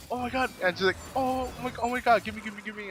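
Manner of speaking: hammed-up grabby excitement; excited gimme. Kara begs for the earrings, squealing with excitement.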